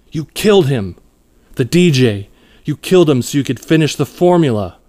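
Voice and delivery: angry accusation. A narrator's reading. Angie confronts Roger over the DJ's killing, accusing.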